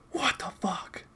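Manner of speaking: hushed, voiceless disbelief; stunned whisper. Real, not acted. Baffled reaction, whispered second take.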